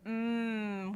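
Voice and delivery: "mm" as one long held hum; nasal M sound. Shown as a demonstration. Teacher introducing the first nasal sound, long hummed M. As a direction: hum "mm" with lips together. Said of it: "the first sound is".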